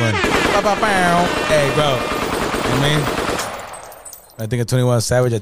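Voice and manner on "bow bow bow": mock gunshot noise. Imitating a sound.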